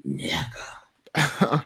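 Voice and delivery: breathy whispered punchline; whispered aside. Acted. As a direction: drop to a secret whisper. Whispered aside after mom story.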